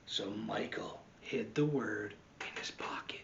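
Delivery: soft whispered storytelling; hushed narration. A narrator's reading. Narrator whispers as Michael hides the word. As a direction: hush it like a secret.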